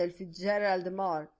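ordinary delivery

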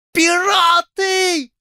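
very high, slow, loud shriek; falsetto scream. Acted. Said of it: "фальцетом заорал полковник". (визг фальцетом)

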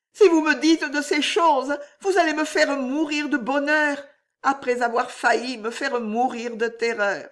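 voix très aiguë et plus forte, exaltée; frémissante d'émotion. A narrator's reading. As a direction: laisse déborder le bonheur. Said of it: "frémissante". (Juliette exaltée par les mots du roi, voix aiguë)

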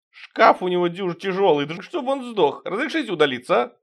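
high whiny servile plea; whining. Acted. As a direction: whine for leave to go. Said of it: "снова заныл". (нытьё)

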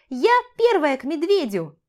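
put-on high, loud childlike shout; excited little girl. A narrator's reading. Child racing her brother indoors, excited shout. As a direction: claim first place in a high, eager yell.

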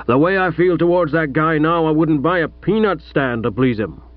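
lightly voiced gruff American father; a hint of an overbearing American millionaire; American tycoon father.

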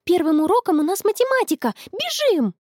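high-pitched hurried alarm; excited, climbing cry; urgent. A narrator's reading. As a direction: urge a friend to run for class. Late for class, urging friend on.

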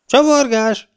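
very high, sustained incredulous squawk; shrieked question. A narrator's reading. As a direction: screech it back in disbelief. (rikoltó, hitetlenkedő visszakérdezés)

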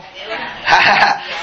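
a quick airy chuckle; brief amused chuckle; short chuckle. Real, not acted. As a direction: laugh at your own point. Preacher laughs at own point.

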